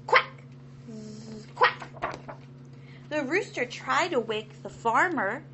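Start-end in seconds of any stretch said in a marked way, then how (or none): 0.1-2.4 sudden loud quack; a sleepy duck blurting out a quack. Acted. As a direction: quack out between snores.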